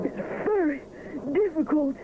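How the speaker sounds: high, strained and out of breath; a hint of puffing. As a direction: push the words out with effort. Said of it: "puffs Edward".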